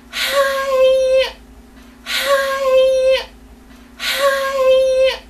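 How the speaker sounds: piercingly high, loud, drawn-out squeal; mock gushing greeting. Two girls greeting the one they fought, mock gushing. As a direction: squeal each greeting like an overexcited fake friend.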